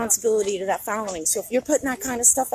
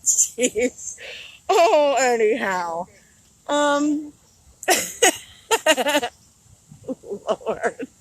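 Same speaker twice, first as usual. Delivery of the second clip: real helpless laughter, louder; laughing fit; 3 semitones higher, 3 dB louder, much slower than usual. Real, not acted. Watching the disappointed man, helpless laughter. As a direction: laugh until it almost cries.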